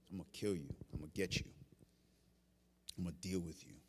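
very low, hushed threat; quiet menace. Acted. Voicing Jezebel's death threat.

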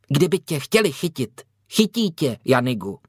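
lightly voiced know-it-all child; precocious. A narrator's reading. Younger boy reasoning with his older brother, precocious. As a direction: answer like an over-wise little brother. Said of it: "odpověděl přemoudřele mladší chlapec".

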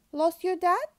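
high, loud questioning little seal; curious seal. Acted. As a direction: ask it like a friendly seal. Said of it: "asked Seal".

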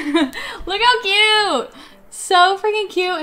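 a real laughing squeal at the cute toy; squealing delight. Real, not acted. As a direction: squeal it out, laughing and near tears. Sees the naked toy.

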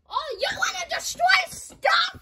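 high exasperated shout; incredulous shout. Real, not acted. Confronting characters who keep destroying stuff, high incredulous shout. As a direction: call them out in disbelief.

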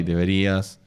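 usual speaking voice

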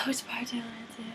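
fast, hushed whisper to herself. Real, not acted. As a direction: mutter the question under her breath.

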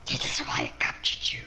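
cold unvoiced whisper; whispering villain. Villain telling the partygoer about his friends, sinister whisper. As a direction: whisper the threat without any voice.

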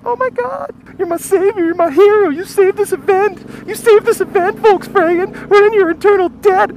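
high falsetto gushing praise; mock grateful crowd. Coworkers thanking the pigeon whisperer, high mock gushing. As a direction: gush like a rescued crowd.

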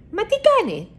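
high, baffled first asking of τι κάνει; a bird staring at a mouse hanging upside down; puzzled surprise. A narrator's reading. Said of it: "ρωτάει η Σούλα".

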